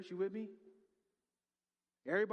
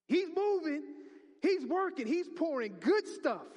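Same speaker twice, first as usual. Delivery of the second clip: loud, high rising proclamation; whooping preacher climax; full-force preaching; 8 semitones higher, 7 dB louder, slower than usual. Acted. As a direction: preach it out to the room.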